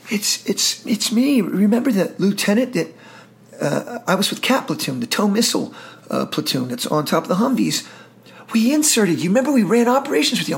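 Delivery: lightly voiced officer, eager and high; eager reminder. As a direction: rush to remind him who you are. Officer eagerly reintroduces himself.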